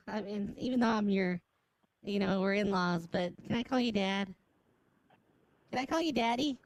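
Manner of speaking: normal talking voice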